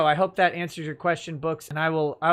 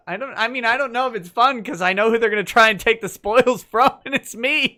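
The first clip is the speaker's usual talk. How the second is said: laughing; 6 semitones higher, 7 dB louder than usual. Real, not acted. Laughing about being taxed.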